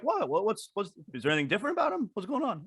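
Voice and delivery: mock innocent. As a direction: play dumb, fading out. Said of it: "I was gonna be like".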